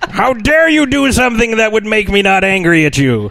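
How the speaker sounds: high, indignant joking shout; mock outrage. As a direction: play up the fake fury.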